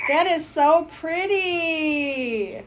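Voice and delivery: slow, crying with joy; tearful awe at the painting; overjoyed crying. Real, not acted. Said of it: "Calm down".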